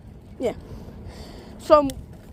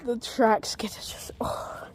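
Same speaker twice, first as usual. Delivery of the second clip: whispering; 4 semitones lower, 7 dB quieter than usual. Real, not acted. Whispering during the ride.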